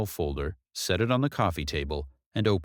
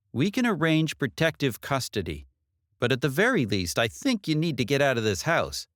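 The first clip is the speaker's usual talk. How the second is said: lightly voiced detective, earnest advice; 6 semitones higher than usual. Detective urging Katie to get out of the house, lightly voiced. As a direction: urge her to leave, firmly.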